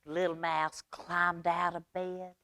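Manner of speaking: usual speaking voice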